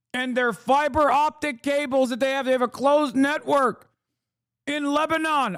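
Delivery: high, shouting rant; worked-up high-pitched rant; excited rant. Real, not acted. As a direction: push the voice way up, indignant. Ranting defensively about Chattanooga.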